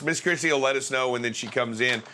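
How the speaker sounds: ordinary talk, nothing marked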